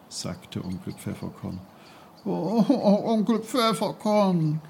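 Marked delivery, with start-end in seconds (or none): 2.2-4.6 put-on high, near-tearful boyish plea; a boy close to tears begging to stay; almost crying. A narrator's reading. Peter, nearly crying, pleading with Onkel Pfefferkorn. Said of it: "Peter weinte fast".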